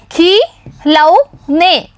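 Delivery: खिलौने drawn out slowly, syllable by syllable; slow sounding out. Shown as a demonstration. Teacher reading खिलौने slowly for the children. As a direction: stretch खिलौने out so children can follow. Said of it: "तो पढ़िए".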